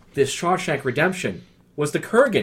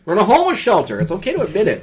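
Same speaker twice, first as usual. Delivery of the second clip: higher, louder teasing declaration; teasing insistence; 7 semitones higher, 4 dB louder, faster than usual. Real, not acted. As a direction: announce it loudly over the protest. Louder repeat teasing the co-host.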